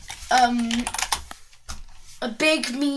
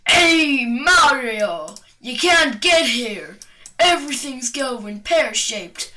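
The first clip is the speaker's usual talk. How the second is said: loud put-on heckling call; Goomba character voice; 4 dB louder, slower than usual. Goomba suddenly confronts Mario, loud character voice. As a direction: call out as a cartoon enemy, louder than usual. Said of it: "And he's just like".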